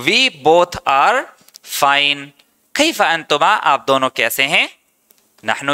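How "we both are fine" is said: very slow. Shown as a demonstration.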